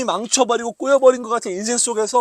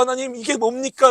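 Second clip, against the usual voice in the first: crying out to God; 5 semitones higher, 4 dB louder than usual. Acted. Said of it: "라고 외치는".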